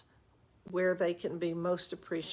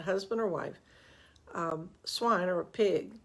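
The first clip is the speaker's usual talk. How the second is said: ordinary delivery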